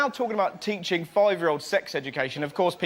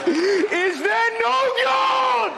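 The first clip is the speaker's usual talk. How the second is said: much higher, louder and slow wail; mock anguished cry; 5 dB louder, much slower than usual. Comic voicing an Englishman's bin grievance, much higher wail. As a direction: howl it to the heavens in mock despair.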